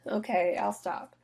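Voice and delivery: ordinary delivery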